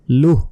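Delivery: লুহ closed on an h with no vowel after; sakin at stop. Shown as a demonstration. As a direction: end লুহ in a bare breath of h. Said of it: "কি উচ্চারণ হবে?".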